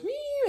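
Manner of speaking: high, soaring "neeow" noise; high flying-car whee. Retold or imitated. Storyteller showing the car leave the road, high soaring sound effect. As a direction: imitate a car sailing airborne.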